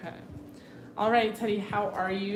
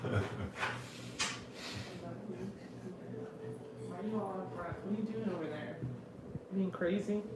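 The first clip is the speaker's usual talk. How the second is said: soft pet talk; 9 dB quieter, much faster than usual. Real, not acted. Talking to the panting dog.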